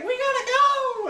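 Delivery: very high, loud squeal; hammed-up panicked cry to flee; mock urgency. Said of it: "and she's like".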